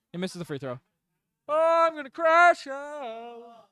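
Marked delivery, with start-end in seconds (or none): 1.5-3.7 high, drawn-out wail of despair; anguished wail. Real, not acted. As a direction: stretch it out slowly like a long howl. Streamer despairing as free throw missed.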